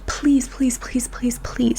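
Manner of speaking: whispered begging, drawn out; pleading whisper. Real, not acted. Podcaster begging listeners in a whisper to send in their stories. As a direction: beg them in a soft whisper.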